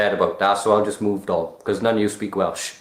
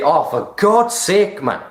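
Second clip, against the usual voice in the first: slow, high exasperated groan of complaint; fed-up Welsh exclamation; exasperated sigh; 6 semitones higher, slower than usual. Retold or imitated. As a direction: drag it out like you've had enough. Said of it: "I always say".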